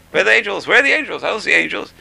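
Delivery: 1 skeptic's voice. Retold or imitated. Mocking a skeptic's voice.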